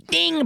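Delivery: a sharp, high-pitched "ding" for the kick; high ding sound effect. Retold or imitated. Fan mimicking the head kick landing with a high ding. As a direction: ring it out like a bell on impact.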